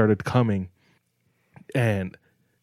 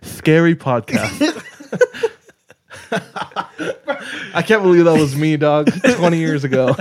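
laughing; 7 semitones higher, 6 dB louder than usual. Real, not acted. Laughing at old recording.

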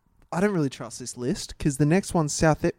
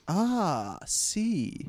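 soft, high Spanish exclamation; Spanish accent attempt; 5 semitones higher, 6 dB quieter than usual. Demonstrating number eight on the list, Spanish accent. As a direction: lightly put on a Spanish accent. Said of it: "number eight is Spanish".

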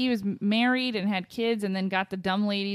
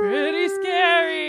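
high, slow ghostly moan; spooky voice; 9 semitones higher, 6 dB louder, slower than usual. Pretend. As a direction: drag it out like a ghost. Echoing spooky sign-off.

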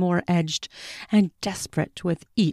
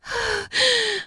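pleasured sigh. Acted. Woman sighs in pleasure.

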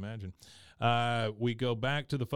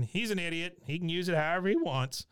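high, quick imitated dismissal; Cotton; 6 semitones higher than usual. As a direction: pipe up scornfully as a cranky father. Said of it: "we get more from Cotton".

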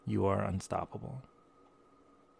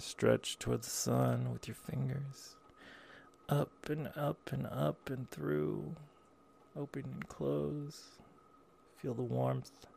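airy breathy mystical healer; 6 dB quieter than usual. Acted.

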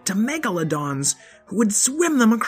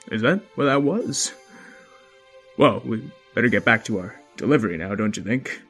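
dad voice; 3 semitones lower, faster than usual. Acted. Father voice.

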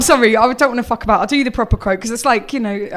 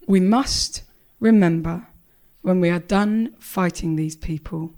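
low, slow, grave with weighty pauses; mock serious; 8 semitones lower, 4 dB quieter than usual. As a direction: hold the gravity to the absurd end. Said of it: "my face was very serious".

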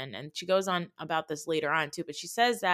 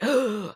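a quick, louder mock gasp; 5 semitones higher, 6 dB louder than usual. Everyone reacting to Jen, a mock shocked gasp. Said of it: "Everybody is like".

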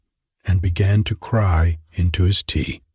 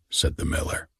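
ordinary delivery

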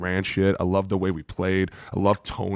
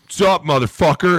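booming high bro greeting; loud greeting; 8 semitones higher, 5 dB louder than usual. Retold or imitated. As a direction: belt it like a pumped-up GM. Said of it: "Spielman was like".

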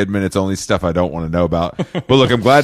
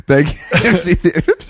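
laughing; 9 semitones higher than usual. Acted. Repeats tagline, laughing.

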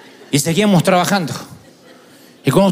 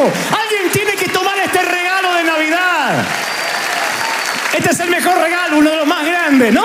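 higher and higher until a near shout; rising preaching fervor; 8 semitones higher than usual. Real, not acted. Preacher urging the congregation, rising to a shout. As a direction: build it up like a preacher at his peak. Preacher's climax on God's grace.

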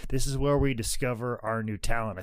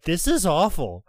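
mildly raised, displeased quote; unhappy viewer; 5 semitones higher, 5 dB louder than usual. Retold or imitated. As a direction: imitate an unimpressed lady, a bit louder. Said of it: "she was not happy".

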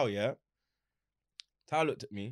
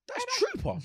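high excited quote. Retold or imitated. Retold family reaction, very high.

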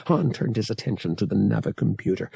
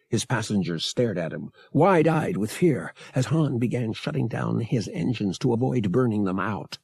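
usual speaking voice

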